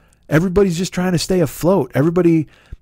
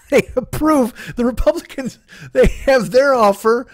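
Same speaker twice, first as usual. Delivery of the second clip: laughing disbelief; 6 semitones higher than usual. Real, not acted. Laughing incredulously at bill.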